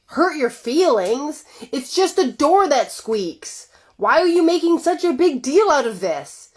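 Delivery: high, slow, incredulous scoff at her hurt; mock the fuss as a husband brushing it off; dismissive husband. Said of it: "And he's like".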